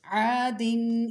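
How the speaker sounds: teacher reciting for pupils to copy, vowels drawn out; drawn-out recitation. Shown as a demonstration. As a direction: stretch each long vowel a full alif. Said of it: "सुनिए".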